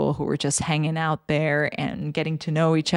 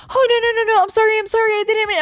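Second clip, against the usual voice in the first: squeaky high, louder flustered backpedaling; panicked apology; 6 dB louder, much faster than usual. Retold or imitated. Scared passenger apologizing to the supervisor, squeaky panic. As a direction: beg off fast in a squeaky panic.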